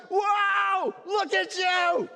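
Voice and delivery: high, loud, delighted welcome; father welcomes his lost son, overjoyed; joyful father. Acted. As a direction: gush over the returning son. Father greets prodigal joyfully.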